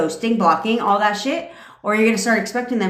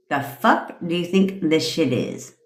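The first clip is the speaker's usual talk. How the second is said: attitude; 4 semitones lower than usual. Shown as a demonstration. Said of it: "with a whole ass attitude".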